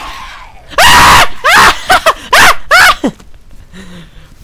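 a loud, high shrieking laugh; high scared laugh. Real, not acted. Gamer laughing after a scare, loud and high.

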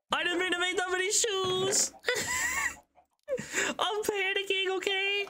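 high whiny fake-crying panic; hammed-up high sobbing panic; mock panic. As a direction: wail it like a panicked child, half laughing.

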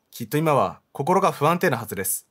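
ordinary talk

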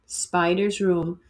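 usual speaking voice

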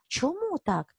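usual speaking voice

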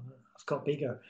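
ordinary delivery

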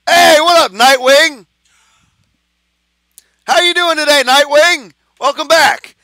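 high, loud, cheerful hello; excited greeting. Real, not acted. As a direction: greet them with big energy. Greeting a viewer by name.

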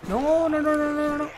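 high, held-up string of noes; high-pitched gamer panic; panicked protest. Real, not acted. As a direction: plead in rising alarm mid-fight. Under attack in game fight.

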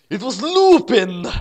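high, loud, drawn-out exclamation; shocked reveal. A narrator's reading. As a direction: announce the name as a shocking reveal.

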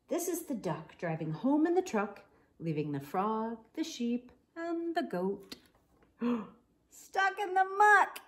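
lively, animated bedtime story reading; picture book read-aloud with voices; reading to children. A narrator's reading. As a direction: read it bright and playful for little kids.

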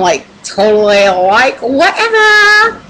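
exaggerated ditzy drawl, last word stretched high and loud; dragged-out airhead impersonation, soaring at the end; mock airhead voice. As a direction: put on a hammed-up "like, totally" voice.

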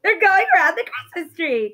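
high shrieked comeback to mom; hammed-up excited protest; acted outburst. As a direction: squeal the first word, then rush on loud and high. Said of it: "I'm like".

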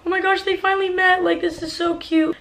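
high, quick gushing over a cute couple; gushing excitement. Retold or imitated. Narrator reliving seeing the couple's Snapchats, gushing. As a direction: squeal it like it's adorable.

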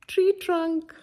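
ordinary delivery

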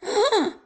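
sharp loud gasp of shock; startled gasp. Acted. Startled by the mouse.

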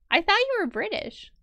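high, stress on you; rising surprise on you; surprised. Real, not acted. Surprised question to a fellow player, high.

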